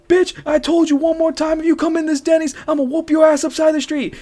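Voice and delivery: mock furious threat; angry chef. The chef threatening her in his Denny's, hammed-up yell. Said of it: "he's like".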